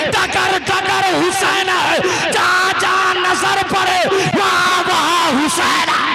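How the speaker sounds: loud, high chant-like sermon shout; full-force preaching. Acted. Preacher voicing sky, earth and lovers claiming Hussain, full-force preaching. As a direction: build a pounding refrain for the crowd.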